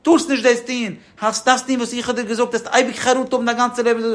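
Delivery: high-pitched mock screaming tirade, held up high throughout; acted screaming rant. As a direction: act out someone yelling in a worked-up fit. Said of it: "beruhig, schreist du".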